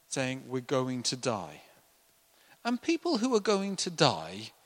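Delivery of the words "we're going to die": normal talking voice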